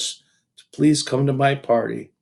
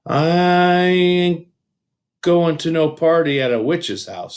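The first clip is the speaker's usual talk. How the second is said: put-on louder, higher defiant boy; stubborn boy; 7 semitones higher, 6 dB louder, slower than usual. A narrator's reading. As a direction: dig in and refuse. Boy refuses loudly.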